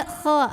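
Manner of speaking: "kha" with airy, raspy friction in the throat; wrong kha sound. Shown as a demonstration. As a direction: say "kha" voiceless, breath scraping the throat. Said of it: "Bukan juga".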